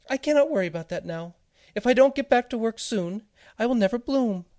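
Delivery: very high, loud, hurried voice; a tiny creature fretting over work; busy little seed. Acted. Seed speaks.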